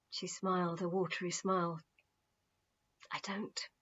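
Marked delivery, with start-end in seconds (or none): 3.1-3.7 whispered. Acted. Peter's wife answering through tears.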